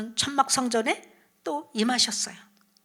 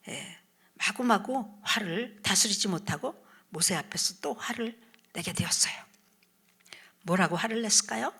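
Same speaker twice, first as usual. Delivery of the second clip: ordinary talk, nothing marked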